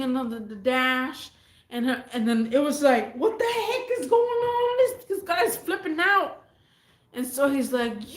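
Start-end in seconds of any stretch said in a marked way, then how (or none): 3.2-6.4 shocked disbelief. Retold or imitated. Narrator's shocked reaction.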